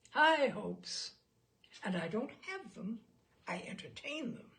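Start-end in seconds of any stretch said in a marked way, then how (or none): 0.1-1.1 emphatic proclamation. Acted. Cliché expert proclaims emphatically.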